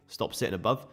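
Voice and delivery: usual speaking voice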